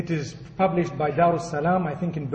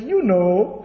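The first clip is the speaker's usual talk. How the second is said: knowing parrot; 4 dB louder than usual. Acted. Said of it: "The parrot says".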